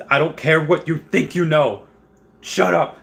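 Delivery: hollering at buddies in mock outrage; mock yell at friends. Texting friends during the hype, mock yelling. Retelling his texts to friends.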